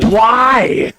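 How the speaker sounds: high, loud mock wail of protest; outraged shout. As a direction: yell it at the screen as the scene cuts off. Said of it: "And I was like".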